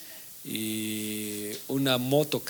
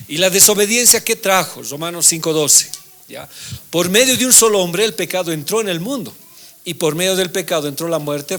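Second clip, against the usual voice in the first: louder, raised scripture proclamation; preaching; 5 semitones higher, 8 dB louder than usual. Acted. Preacher reading Romans to class, raised proclaiming voice. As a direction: proclaim the verse to the room.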